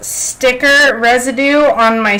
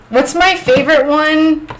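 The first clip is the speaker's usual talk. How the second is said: high questioning; 5 semitones higher than usual. Real, not acted. Answering a question about British names, high and questioning. Repeats chat's question, then ponders it.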